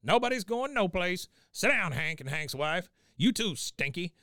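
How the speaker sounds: put-on high, bossy old-man command; Cotton. Cotton bangs on the table, high bossy order. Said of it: "Cotton just bangs on the table".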